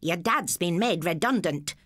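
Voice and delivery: ordinary delivery, nothing marked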